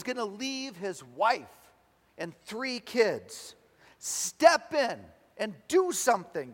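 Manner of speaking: loud, high plea to heaven; fervent acted prayer. Man praying over Tony for Charlie's family, loud fervent plea. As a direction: cry out slowly and insistently to God. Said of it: "and praying".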